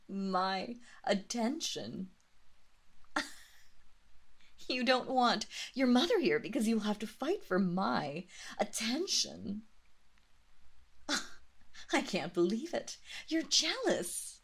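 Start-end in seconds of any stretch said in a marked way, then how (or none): 2.9-4.6 soft unvoiced chuckle; breathy laugh. Mistress chuckling at her servant. 11.0-14.4 delighted laugh. Acted. Mistress delighting in her servant's jealousy.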